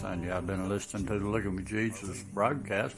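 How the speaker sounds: low, calm radio host read; broadcast sign-off. Acted. Host signing off his radio broadcast, calm low read. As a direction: wrap up the show easy and low.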